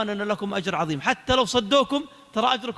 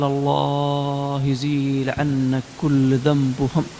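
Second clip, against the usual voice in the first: very slow, low, stretched-out prayer; drawn-out blessing; 8 semitones lower, much slower than usual. Retold or imitated. Man blessing the one who reconciled him, slow and heartfelt. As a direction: draw the prayer out long and low.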